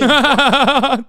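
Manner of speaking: loud burst of laughter; real laugh. Real, not acted. Streamer reacting to the played clip, loud laughing. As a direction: crack up in a rapid, loud cackle.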